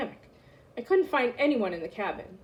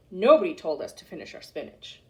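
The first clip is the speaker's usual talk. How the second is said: ordinary delivery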